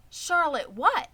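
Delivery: high, loud eager question. Acted. Piglet Wilbur eagerly asks the spider her name. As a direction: ask it eagerly, leaning in. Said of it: "asked Wilbur eagerly".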